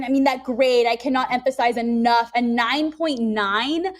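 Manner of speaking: ordinary talk, nothing marked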